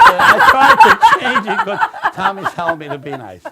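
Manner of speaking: high, loud, slow through laughter; real helpless laughter while talking; laughing excuse. Real, not acted. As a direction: barely get the words out for laughing. Laughing while excusing his joke.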